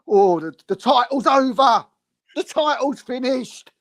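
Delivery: high, despairing wail that the title is over; high mock lament; mocking doom-monger fan. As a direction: ham up a fan declaring it all lost. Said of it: "and it's like".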